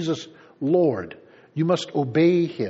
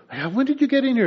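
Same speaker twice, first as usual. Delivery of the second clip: a parent's raised, puzzled question, pitched higher; surprised parent; 7 semitones higher, faster than usual. Retold or imitated. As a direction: catch the kid sneaking in. Parent noticing sneaking child.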